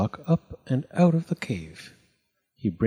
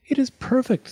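delighted; 3 dB louder than usual. A narrator's reading. Circle delighted by the sculpture.

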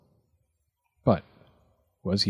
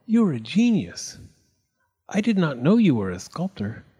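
higher, gushing praise; admiring Circle; 6 semitones higher than usual. A narrator's reading. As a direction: marvel at the genius. Circle admires Square's block.

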